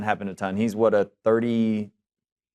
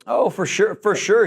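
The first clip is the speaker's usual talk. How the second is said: raised and loud, eager agreement; emphatic; 9 semitones higher, 4 dB louder than usual. Real, not acted. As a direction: jump in with a loud for sure.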